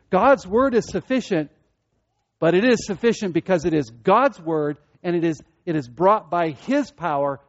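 ordinary delivery

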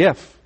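if pushed out with extra force; weighted if. Shown as a demonstration. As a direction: give if its full weight. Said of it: "feel the weight of the".

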